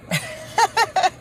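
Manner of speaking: a short high burst of laughter. Real, not acted. Laughing at her own wisecrack to viewers, a high burst. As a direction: laugh at your own joke.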